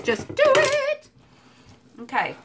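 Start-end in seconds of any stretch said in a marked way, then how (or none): 0.0-1.1 slow, high mock ad-voice slogan; Nike slogan. Quoting Nike while adding pecans, slow high slogan voice. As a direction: intone it like a sneaker ad. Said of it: "like Nike says".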